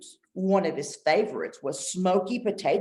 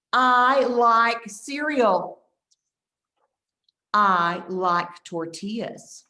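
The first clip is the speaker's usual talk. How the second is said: very slow, louder, clearly spaced; slow repeat-after-me model; 3 dB louder, much slower than usual. Shown as a demonstration. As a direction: stretch each word so the children can echo. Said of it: "I'll say it and you repeat it".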